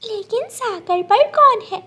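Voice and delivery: put-on a small child asking how to get home; little bird child. Gudiya asking the cloud for help, high worried child voice.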